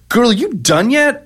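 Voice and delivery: high, slow, sing-song impatient nudge; drawn-out and lilting, teasing her to hurry; mock Breckin Meyer quote. Retelling the buffet scene to his co-host, high drawn-out teasing. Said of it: "turning to the woman next to him and saying".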